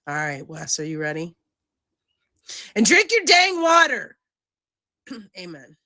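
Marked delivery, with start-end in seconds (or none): 2.8-4.2 high, loud mock scolding; playful loud command. Real, not acted. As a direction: bark it out like a teasing mom.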